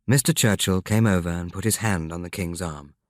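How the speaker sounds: usual speaking voice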